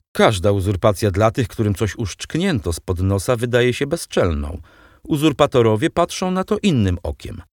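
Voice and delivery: usual speaking voice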